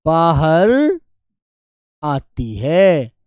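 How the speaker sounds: very slow, word by word, for writing down; slow dictation. Shown as a demonstration. Teacher dictating notes to students, very slowly. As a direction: spell it out slowly so they can copy. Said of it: "तो लिखेंगे".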